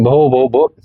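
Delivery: a mild imitation of a child's stammer on भो, भो, भो. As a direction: repeat भो haltingly, a light mock stammer. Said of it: "लड़खड़ाती हुई आवाज में बोलती हैं". (हल्की नकल में हकलाते हुए)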